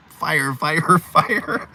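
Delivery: high, laughing mock chant of fire; kids echoing the yell. Cousins copying the cry without understanding, high laughing mock yell. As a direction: copy the cry without knowing it. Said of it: "followed along going".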